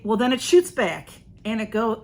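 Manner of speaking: normal talking voice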